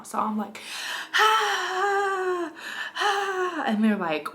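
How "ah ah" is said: high, pained yelling through a contraction; labor yell. Retold or imitated. On the phone with midwives mid-contraction, high pained yells. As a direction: cry out with each wave of pain. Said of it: "I'm yelling through these contractions".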